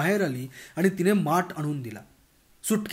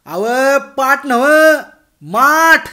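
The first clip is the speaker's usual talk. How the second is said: loud, slow, higher shout; exasperated shout; 8 semitones higher, 13 dB louder, much slower than usual. Acted. Bhagwan correcting the deaf girl, exasperated loud shout. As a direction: yell the correction at her.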